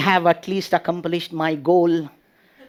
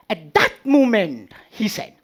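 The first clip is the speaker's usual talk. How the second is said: ordinary talk, nothing marked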